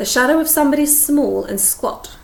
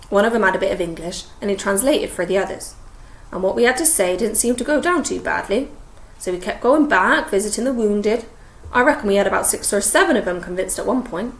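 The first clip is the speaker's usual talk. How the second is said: ordinary delivery, nothing marked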